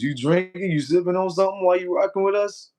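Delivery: high, playful mock surprise; surprised teasing. Real, not acted. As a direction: tease him like you caught him. Teasing a viewer about drinking.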